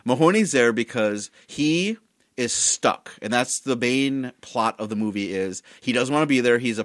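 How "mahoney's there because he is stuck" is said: ordinary delivery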